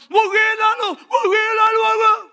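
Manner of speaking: high, loud hammed-up shriek of alarm; exaggerated panicked yelp; mock panic. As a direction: overreact like the problem is huge. Mocking panic over problems.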